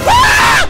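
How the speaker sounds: very high, loud scream; piercing startled shriek. Real, not acted. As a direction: scream in sudden fright. Killed by monster in game.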